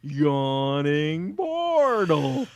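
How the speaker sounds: put-on very slow, drowsy Boston accent drawl; half asleep, dragging every word out; sleepy tavern owner. Tavern owner directs lost adventurers across the street, drowsy and slow. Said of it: "He's like".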